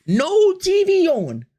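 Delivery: high, loud, slow disbelief; high, drawn-out outburst; incredulous. Real, not acted. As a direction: stretch it out in disbelief. Emphatic disbelief.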